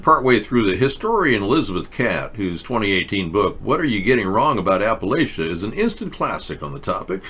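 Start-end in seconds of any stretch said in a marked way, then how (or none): none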